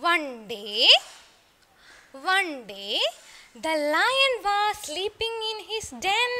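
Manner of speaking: put-on slow, sing-song storytelling line for kids; slow story recital. Shown as a demonstration. Teacher reciting the story slowly to her pupils. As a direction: draw each word out for the class to repeat.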